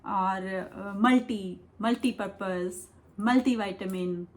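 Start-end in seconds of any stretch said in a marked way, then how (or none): 1.0-4.3 मल्टी with "ee", also inside मल्टीपर्पज, मल्टीविटामिन; Indian pronunciation. Shown as a demonstration. Teacher recapping Indian usage, मल्टी said "MUL-tee". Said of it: "हम India में से बोलते हैं".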